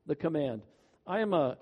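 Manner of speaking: usual speaking voice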